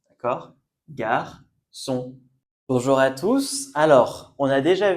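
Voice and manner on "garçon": "Garçon" in two separate syllables, gar, son; soft C. Shown as a demonstration. Teacher repeating the word for learners to follow, syllable by syllable. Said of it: "On prononce garçon".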